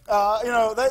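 usual speaking voice

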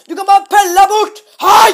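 a loud, high bellow, close to a scream; full-force furious yell at the man; real-volume shout. Shown as a demonstration. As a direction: shout it as loud as he really did. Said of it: "jeg kan si det han sa på ekte".

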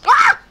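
sudden high, loud shriek; startled scream. Real, not acted. Player caught by killer, startled scream. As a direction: scream at a jump scare.

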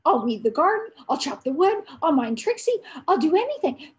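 put-on boy promising father chores for the pup; stammering with happiness. A narrator's reading. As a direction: tumble out the promises, overjoyed. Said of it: "stammering with happiness".

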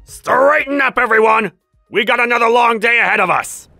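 high, loud rallying orders; commanding overseer. Acted. Overseer to the assembled workers, commanding shout. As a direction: shout orders to a whole crew.

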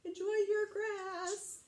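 high, slow, meek send-off; beaten troll. Acted. Troll back under his bridge to the goat, meek. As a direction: call it meekly in a high voice.

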